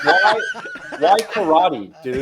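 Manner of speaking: exasperated mock outrage, laughing; asking his friend why karate, half laughing. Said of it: "And I'm just like".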